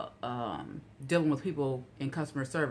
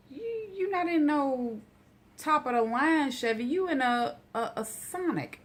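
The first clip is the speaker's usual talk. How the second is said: high, indignant you in a Sonic; sassy disbelief at the bill; incredulous scoff; 11 semitones higher than usual. Real, not acted. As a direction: scoff at the idea, voice pitched up. Mocking the overpriced repair.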